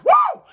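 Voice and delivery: high, loud preacher's whoop; ecstatic woo. Real, not acted. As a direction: whoop it out in fervor.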